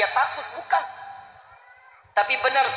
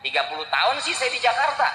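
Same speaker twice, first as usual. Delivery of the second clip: ordinary delivery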